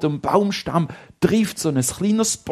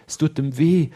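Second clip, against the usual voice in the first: usual speaking voice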